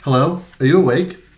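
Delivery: bright, lifted nurse voice; gentle nurse. Acted. Nurse wakes patient.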